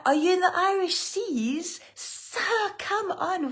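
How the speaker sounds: squeaky pretend-offended "Sir, come on"; mock indignation. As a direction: scold a viewer in jest. Teasing a viewer's location comment.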